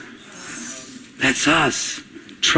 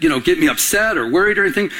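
usual speaking voice

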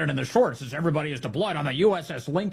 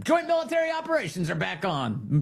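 high, sustained announcer-like call; mock proclamation; 5 semitones higher than usual. Host mimicking the president reopening the floodgates, mock proclamation. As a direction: call it out on one raised note.

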